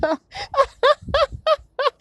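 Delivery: loud high squealing laugh; real laugh bursting out high; high burst of laughter. Real, not acted. Cracking up at own story.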